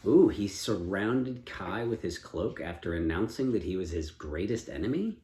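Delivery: ordinary delivery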